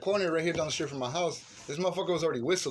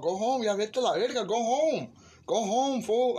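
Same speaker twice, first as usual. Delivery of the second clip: retold yell; 6 semitones higher, slower than usual. Retold telling guy to leave.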